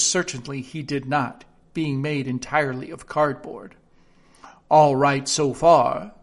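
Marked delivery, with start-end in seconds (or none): 4.7-6.1 slow, loud pompous king. A narrator's reading. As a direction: drag it out like a smug king. The king's voice in story.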